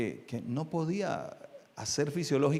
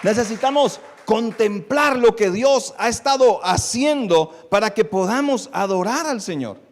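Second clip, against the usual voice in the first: raised, emphatic sermon voice, pushing key words loud; impassioned preaching; 7 semitones higher, 8 dB louder than usual. Real, not acted. Preacher urging congregation to contemplate God, impassioned preaching. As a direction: exhort the congregation at full force.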